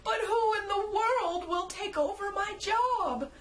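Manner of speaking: a mock sob cracking the high voice; sobbing. Santa wailing to the narrator about his job. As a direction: wail it like a comic sob. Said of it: "cracked a sob".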